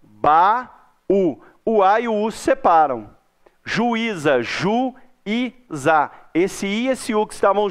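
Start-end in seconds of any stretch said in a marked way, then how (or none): 0.0-1.4 "Ba, U" em duas sílabas isoladas e firmes; separando sílabas. Shown as a demonstration. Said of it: "eu vou separar essas sílabas". 4.4-6.1 "ju e za" bem devagar, como ju... i... za; separando sílabas. Shown as a demonstration. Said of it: "eu vou separar essas sílabas".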